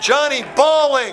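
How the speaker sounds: loud, high announcer's cry; excited play-by-play shout; goal call. Real, not acted. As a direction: call the scorer's name out big.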